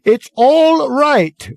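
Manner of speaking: high, loud, very slow bellow. Acted. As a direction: drag it out like a loudspeaker. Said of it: "Ford continued to bellow".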